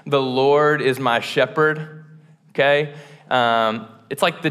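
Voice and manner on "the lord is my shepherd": slow, weighted "The Lord is my shepherd"; deliberate recitation, "Lord" stressed; recited phrase. Shown as a demonstration. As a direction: say it like a famous quote. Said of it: "heard this phrase".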